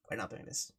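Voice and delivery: muttered low under the breath. Real, not acted.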